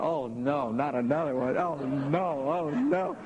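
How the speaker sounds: imitating parents hearing another story; mock dismayed parents. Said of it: "they go".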